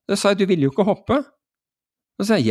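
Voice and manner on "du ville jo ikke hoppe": high-pitched acted objection; mock-baffled pointing out; retold confrontation. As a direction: challenge them in disbelief. Acted dispute with the student.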